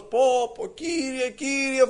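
high, drawn-up cry of complaint; mock crying out. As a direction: act out a desperate call to God. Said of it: "φώναζε".

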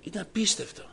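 repeating it, struck with awe. Retold or imitated. Repeats in greater astonishment.

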